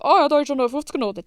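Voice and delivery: quick, loud and high, tossed off; mock carefree delight. Mocking careless spending, quick loud cheerful voice. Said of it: "Und nicht einfach".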